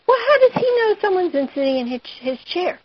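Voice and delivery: high, fast, quizzical voice; a touch of a mocking character; mock puzzled voice. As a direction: asks in a high, put-on voice. Teacher questions in mock voice.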